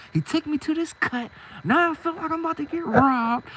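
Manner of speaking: high-pitched, aggrieved rant; agitated complaint. Retold or imitated. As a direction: pour out the grievance in a high, worked-up voice. Retelling his angry complaint to friend.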